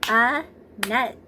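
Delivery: "Annette" in two separate beats, one per clap; clapped-out syllables. Shown as a demonstration. Teacher clapping out their name for preschoolers, syllable by syllable. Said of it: "Let's clap and find out".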